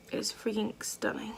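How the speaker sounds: soft awed whisper. Real, not acted. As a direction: whisper it close to the mic. Admiring eyeshadow on camera.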